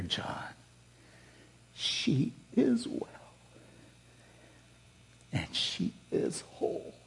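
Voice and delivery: slow, hushed whisper with long pauses. Retold or imitated. Preacher voicing the Holy Spirit's answer to him, slow whisper. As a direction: whisper it slowly, as reassurance into an ear. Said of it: "I sensed the Holy Spirit whisper in my ear".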